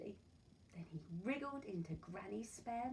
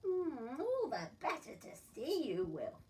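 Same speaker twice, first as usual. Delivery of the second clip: chuckling wolf; 5 semitones higher, 5 dB louder than usual. Acted. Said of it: "the wolf chuckled".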